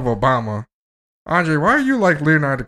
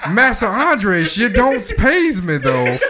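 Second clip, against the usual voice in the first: higher, slow mock servant voice with laughter; 9 semitones higher, 4 dB louder, slower than usual. Teasing his friend, a high laughing servant voice. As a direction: play the meek servant, giggling.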